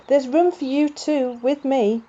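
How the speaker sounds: loud, high, happy call across the crowd. Acted. Jumper calls Wobble up onto the wheelbarrow, loud and high. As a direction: hail your friend from the parade float.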